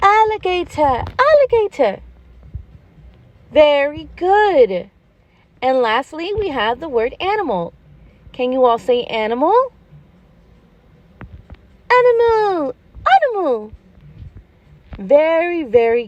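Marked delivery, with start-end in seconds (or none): 0.0-2.0 put-on much higher childlike echo of "alligator"; eager child repeating "alligator"; kids repeating. Pretend pupils repeat the word. 11.9-13.7 put-on much higher childlike echo of "alligator"; eager child repeating "alligator"; kids repeating. Pretend pupils repeat the word.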